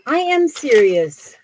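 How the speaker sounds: loud, high, slow insistence; emphatic insistence. Real, not acted. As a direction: punch "am" and draw out "serious". Answers "Are you serious?.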